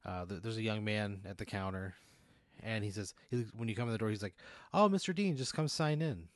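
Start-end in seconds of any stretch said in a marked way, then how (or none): 4.7-6.3 higher, louder front-desk greeting; welcoming desk clerk. Acted. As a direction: greet an expected visitor, pitched up and loud. Game master voices welcoming desk clerk.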